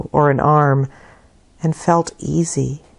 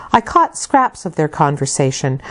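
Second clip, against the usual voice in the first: usual speaking voice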